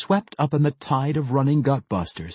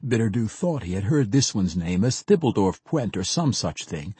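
usual speaking voice

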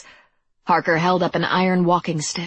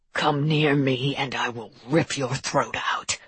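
menacing monster; 3 semitones lower, 3 dB quieter than usual. A narrator's reading. Captive monster threatens his captor.

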